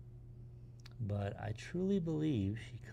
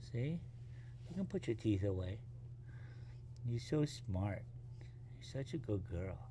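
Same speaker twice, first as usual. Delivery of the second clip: softer and gentle, praising a calmed puppy; soft soothing praise; 3 semitones lower, 11 dB quieter than usual. Real, not acted. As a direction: quietly reassure her she's good.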